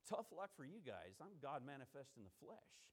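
low, quiet, detached mock dismissal; stone-faced indifference. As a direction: play someone coolly unmoved by others' grief. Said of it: "sit there with a stone face and say".

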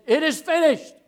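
loud, high, head-up declaration; strong triumphant cry. Acted. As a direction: cry it out valiantly, not beaten. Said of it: "He's not down here saying".

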